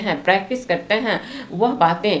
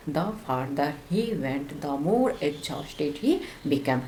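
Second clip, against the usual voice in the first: slow model sentence; 4 semitones lower, 8 dB quieter, much slower than usual. Shown as a demonstration. Teacher softly reading the example slowly. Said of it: "यह far का हो गया further".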